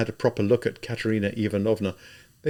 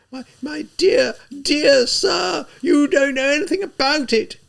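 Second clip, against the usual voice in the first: high, loud, slow shout; 6 dB louder than usual. A narrator's reading. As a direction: shout down the official. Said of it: "Katerina Ivanovna shouted".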